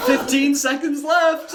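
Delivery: high, slow, loud call through laughter; loud time call. Real, not acted.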